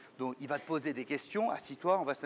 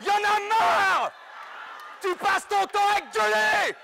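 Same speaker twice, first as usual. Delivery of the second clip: high, loud exasperated yelling; host screaming at guest to shut up; furious shout; 7 dB louder than usual. Acted. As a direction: blow up at him, screaming. Host loses temper, screams.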